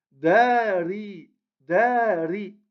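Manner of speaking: "Dari" with a long held "Da" and unlengthened "ri"; long first syllable. Shown as a demonstration. Teacher showing which vowel lengthens, long "Da" in "Dari". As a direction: stretch the "Da" in "Dari", then say "ri". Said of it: "On allonge le da et on prononce le ri".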